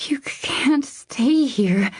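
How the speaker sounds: high, slow, halting protest; stammering. A narrator's reading. Frightened woman telling him he cannot stay, stammering. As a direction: stammer it out high, partly whispered. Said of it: "she stammered out".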